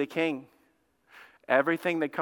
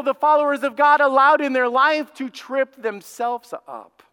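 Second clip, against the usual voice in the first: high, slow, emphatic proclamation; full-force preaching; 9 semitones higher, 5 dB louder, slower than usual. Real, not acted. Preacher telling church Satan cannot stop us, full-force. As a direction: drive each word home to the congregation.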